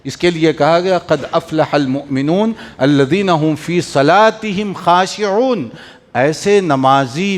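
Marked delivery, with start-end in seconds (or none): 1.0-5.7 slow, measured Quranic chant; Quran recitation. Acted. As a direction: recite the verse slowly and reverently. Quran verse quoted in sermon.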